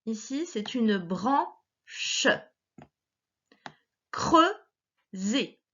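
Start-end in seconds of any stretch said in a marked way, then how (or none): 1.0-2.3 "branche" in two slow beats, bran-che; "branche" divided bran / che, final e voiced; split into syllables. Shown as a demonstration. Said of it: "la première syllabe de chaque mot". 4.1-5.6 "Creuser" in two separate beats, creu-ser; "Creuser" with a clear gap, creu / ser; split into syllables. Shown as a demonstration. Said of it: "la première syllabe de chaque mot".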